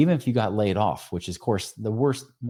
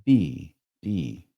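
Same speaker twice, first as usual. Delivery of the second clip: slow single word mantra; 4 semitones lower, much slower than usual. Shown as a demonstration. Ending his meditation demo for listeners, slow and hushed. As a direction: fade into stillness on the word. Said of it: "Then I would end on be".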